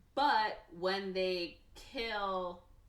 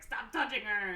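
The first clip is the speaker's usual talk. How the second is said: mock outburst, higher and louder; retold jealous protest; 4 semitones higher, 5 dB louder than usual. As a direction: imitate a jealous telling-off. Jealous man objects to the touching.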